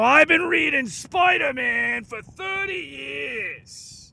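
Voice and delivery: loud, slow gruff shout. Retold or imitated. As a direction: bellow it like a gruff fanboy. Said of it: "in his gruff voice".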